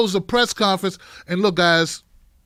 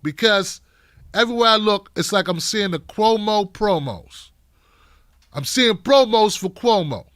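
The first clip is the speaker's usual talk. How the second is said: ordinary delivery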